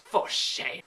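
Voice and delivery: unvoiced whisper scolding herself; mock tut in a whisper; breathy mock reproach; whispered mock shame. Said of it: "whispered self-scold".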